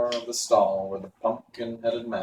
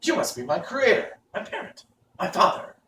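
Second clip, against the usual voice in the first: surprised; 10 semitones higher than usual. Acted. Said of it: "in a tone that expressed surprise".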